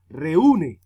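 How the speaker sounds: "reúne" with the U hit hard and loud; "reúne", heavy emphatic U; stress on U. Shown as a demonstration. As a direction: punch the U in "reúne", stretching it. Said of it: "suena más duro la U".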